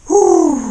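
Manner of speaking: sharp high hoot from the cold; loud high whoop. Real, not acted. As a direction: let out a loud high "ouh". In the cold water.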